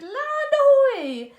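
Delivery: high, slow, loud call; shouting. A narrator's reading. Lookout spotting the island, loud high shout. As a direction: call it out from the crow's nest. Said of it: "a voice from the crow's nest shouted".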